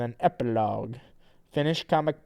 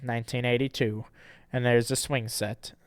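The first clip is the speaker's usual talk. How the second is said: ordinary talk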